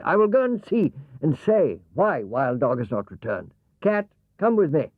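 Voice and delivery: Wild Horse calling out boldly, rising on Cat. Acted. Wild Horse tells Cat to come, bold horse voice.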